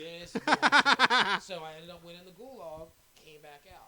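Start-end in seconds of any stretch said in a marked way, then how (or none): 0.0-1.4 loud, slow, laughing shout; retold rage at being run over; furious drawn-out yell. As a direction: stretch it out in a rage. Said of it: "I said".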